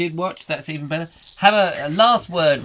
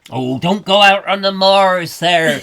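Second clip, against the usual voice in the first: warning moor local; 2 semitones higher, 7 dB louder than usual. Acted. Local warns visitor off the moors, mock alarm.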